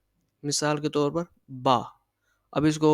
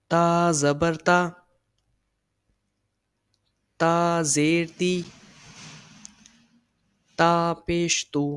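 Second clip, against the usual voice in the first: slow, careful drill of the vowels; slow recitation; slower than usual. Shown as a demonstration. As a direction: spell each syllable out slowly for the class. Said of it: "نہ لمبا کھینچنا ہے". (آہستہ آہستہ سبق پڑھانا)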